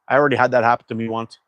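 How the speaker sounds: ordinary delivery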